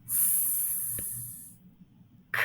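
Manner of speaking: long hissed "S", pause, short "k"; sounding out. Shown as a demonstration. Teacher showing the first sounds of school, sounding out. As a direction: isolate "S" and "k" as pure sounds. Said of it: "Now, first sound".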